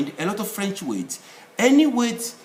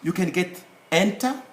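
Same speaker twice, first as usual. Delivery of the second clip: ordinary talk, nothing marked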